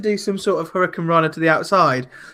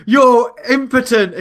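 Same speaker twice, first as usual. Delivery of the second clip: slow, higher mock accusation; retold accusation; 5 semitones higher, 4 dB louder than usual. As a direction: call it out slowly, imitating him. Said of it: "he goes".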